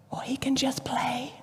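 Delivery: soft whispered "Or he can just play"; hushed. Shown as a demonstration. Preacher offering the playful choice, in a hushed whisper. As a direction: breathe it gently as an invitation.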